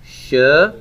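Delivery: sh-mo-tam slowly as "shuh ... mo ... tam"; syllable by syllable. Shown as a demonstration. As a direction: spell out sh-mo-tam in drawn-out, separate syllables. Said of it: "their names written here".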